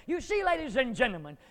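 ordinary talk

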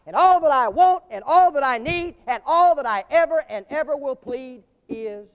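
high, loud, impassioned declamation; preaching at full force. Real, not acted. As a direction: proclaim the verse like a climactic testimony.

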